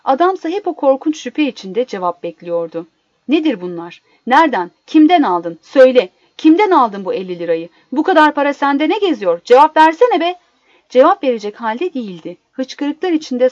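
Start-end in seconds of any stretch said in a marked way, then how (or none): 3.3-10.4 loud, accusing questions building to a shout; hard questioning, louder towards the end; angry interrogation. Acted. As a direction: demand answers with mounting anger. Suspicious husband interrogates sobbing wife.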